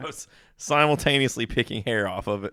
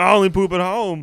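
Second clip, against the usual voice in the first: high, loud mock protest; hammed-up loud schoolyard denial; defensive kid; 9 semitones higher, 8 dB louder than usual. Acted schoolyard quarrel.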